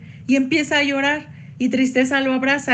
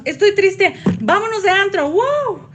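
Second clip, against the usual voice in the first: mock party excitement; 8 semitones higher, 3 dB louder than usual. Mocking party-going denial of sadness.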